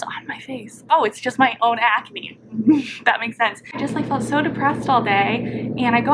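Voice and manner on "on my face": a startled breathy whisper to the camera; under the breath, peering at own face. Real, not acted. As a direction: hush it out, puzzled at the screen.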